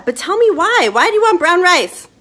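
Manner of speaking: high, loud playful shout; mock-outraged demand. Cook challenging viewers on brown rice, playfully shouting. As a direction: demand an answer in mock exasperation.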